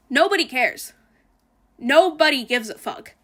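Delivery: usual speaking voice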